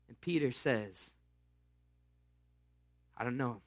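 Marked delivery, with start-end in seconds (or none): 3.1-3.6 low, softer denial; a nervous fisherman denying quietly. Acted. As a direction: mutter it, cornered.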